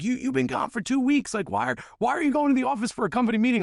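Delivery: high-pitched, fast mock scolding yell; mock yell of the wife. Wife yelling at him over the phone, high-pitched fast mock yell. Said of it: "screaming at me".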